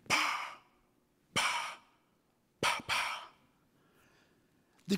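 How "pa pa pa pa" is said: four sharp "pa" gunshot noises; imitated gunshots. Storyteller imitates firing squad shots, sharp vocal pops. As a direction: mouth the shots, one by one.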